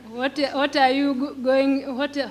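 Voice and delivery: normal talking voice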